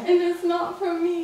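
soft, tearful protest; crying. Real, not acted. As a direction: protest quietly through tears. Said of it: "Are you seriously crying right now?".

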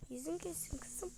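unvoiced tender whisper. Mother whispering a blessing to her daughter. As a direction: breathe it softly.

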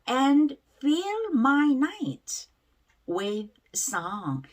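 very slow, drawn-out reading; pronunciation demo. Shown as a demonstration. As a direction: stretch each word for learners to repeat. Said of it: "발음 한번 따라해볼게요". (따라 하도록 아주 천천히 늘여 읽는 발음 시범)

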